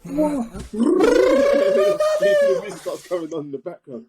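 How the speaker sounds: very high, giddy hype; hyped-up retelling. Retold or imitated. Retelling the gym encounter, very high excited hype. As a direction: build it like an entrance moment. Acting out the fan's moment.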